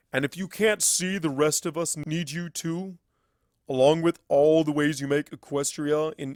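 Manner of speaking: lightly voiced mentor, earnest and firm. Starswirl telling Twilight she is needed, earnest hint of mentor. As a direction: admit it plainly, then press the point.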